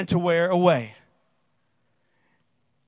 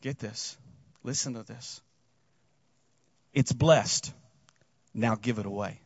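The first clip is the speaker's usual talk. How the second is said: hushed instruction; 11 semitones lower, 7 dB quieter than usual. Retold or imitated. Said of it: "he tells them".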